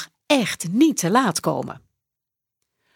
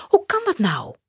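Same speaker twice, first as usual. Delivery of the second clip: put-on high, bewildered boy's question; startled boy; 12 semitones higher than usual. Max realises he is late, startled and high. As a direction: sound caught off guard, much higher.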